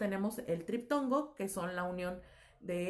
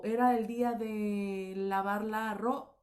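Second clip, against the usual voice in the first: drawn-out example sentence; slower than usual. Shown as a demonstration. Teacher shows a word cut at the line's end, drawn out. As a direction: slowly voice the written line, cutting off the last word.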